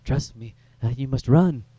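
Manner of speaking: lightly voiced odd doctor urging escape; creepy doctor impression. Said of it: "it's just like".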